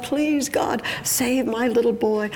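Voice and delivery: high-pitched, acted desperate prayer; pleading call. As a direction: beg God as a frantic mother. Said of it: "she calls out to God".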